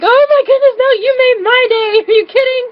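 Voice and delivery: high, gushing excited disbelief; giddy high-pitched gratitude; thrilled. Retold or imitated. Acts out thrilled reply to caller.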